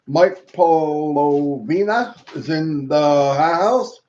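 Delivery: slow, stretched-out shout-out; oddly drawn-out intro. Real, not acted. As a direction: draw out the words like a playful announcer. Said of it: "I don't know why I said it like that".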